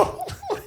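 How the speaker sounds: short high laugh at the joke; burst of laughter. Real, not acted. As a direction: let a real laugh break out high.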